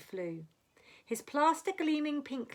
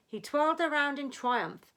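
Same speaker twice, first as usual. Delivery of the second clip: ordinary delivery, nothing marked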